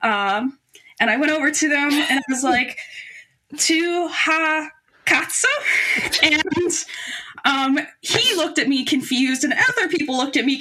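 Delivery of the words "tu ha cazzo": slow, halting beginner Italian; broken Italian. Retold or imitated. Asking the man in broken Italian, slow and halting. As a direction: sound out the foreign words carefully. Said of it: "in my god-awful broken Italian".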